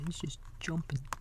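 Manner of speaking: usual speaking voice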